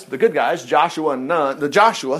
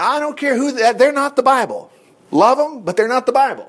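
usual speaking voice

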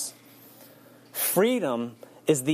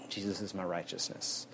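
softer, lower murmur; quiet affirmation; 6 semitones lower, 11 dB quieter than usual. Shown as a demonstration. Preacher modelling answer to criticism, soft murmur. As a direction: settle into it quietly.

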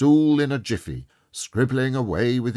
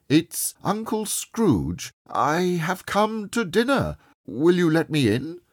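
calling at the door; 7 semitones higher than usual. Acted. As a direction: announce yourself through the door, pitched high. Scrooge announces himself at nephew's door.

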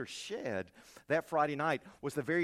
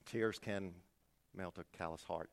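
quiet quote; 8 semitones lower, 8 dB quieter than usual. Retold or imitated. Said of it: "I was gonna quote Alice in Chains".

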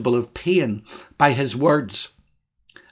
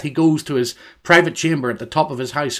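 ordinary talk, nothing marked

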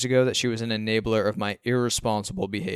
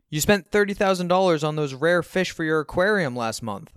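higher, louder accusing protest; exclaiming; 6 semitones higher, 5 dB louder than usual. A narrator's reading. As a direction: exclaim it in raised-voice disbelief. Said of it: "I exclaim".

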